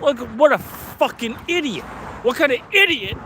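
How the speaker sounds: high-pitched exasperated cursing; yelping at himself in disbelief; angry outburst. Real, not acted. Man who just dropped his wine, cursing himself in high frustration.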